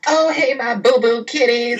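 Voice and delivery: squealing high, over-the-top party greeting; high-pitched hammed-up hello; Natty. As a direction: imitate a gushy diva making her entrance. Imitating Natty's gushy bar entrance.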